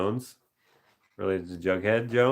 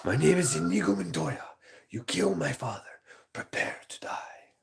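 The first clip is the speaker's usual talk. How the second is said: higher, fading to a whispered threat; proud duelist, ending in a hushed threat; Inigo Montoya; 8 semitones higher than usual. Retold or imitated. Streamer quoting Inigo Montoya, proud then whispered. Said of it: "I think I'm gonna do".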